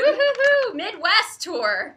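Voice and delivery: high, loud whoop; cheering the Midwest tour stop. Real, not acted. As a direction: whoop for the home region.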